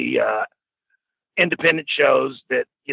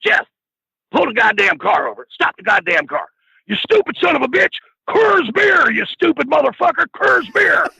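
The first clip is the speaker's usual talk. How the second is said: retold angry tirade; 12 semitones higher than usual. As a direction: berate him from the back seat, building to a screech. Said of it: "he looks at my friend Jeff and he goes".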